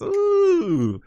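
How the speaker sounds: a very high, loud delighted ooh; a sudden high ooh of excitement; excited ooh. Real, not acted. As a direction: squeal with real delight. Sees faster engineers.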